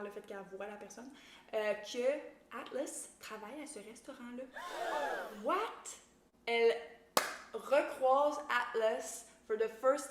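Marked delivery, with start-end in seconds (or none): 4.5-5.4 sharp high gasp of surprise; huge theatrical gasp; shocked gasp. Real, not acted. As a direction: gasp as if you can't believe it. Shocked at recap twist.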